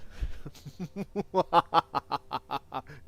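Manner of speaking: whispered villain chuckle; breathy, sinister little laugh; evil laugh. Acted. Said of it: "in evil voice".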